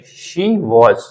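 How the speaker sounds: "she was" as shee wawz; recommended pronunciation. Shown as a demonstration. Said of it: "आप लोग इधर shift करें". ("she was" को शी वॉज़)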